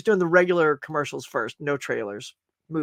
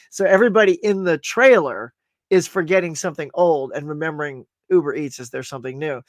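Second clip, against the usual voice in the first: ordinary talk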